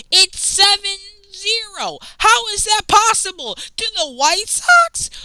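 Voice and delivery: high, incredulous outburst; can't believe the score, voice jumps way up; shocked pitch leap at a baseball score; disbelief. Real, not acted. Outraged at Padres score.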